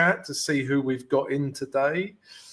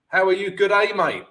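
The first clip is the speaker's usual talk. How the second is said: put-on playful, higher-pitched Australian accent; 5 semitones higher, 4 dB louder than usual. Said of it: "That's my Australian accent".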